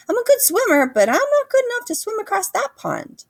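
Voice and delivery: very high, wondering gosling voice; a small gosling staring at the ocean; awed gosling. A narrator's reading. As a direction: marvel like a small bird at the sea.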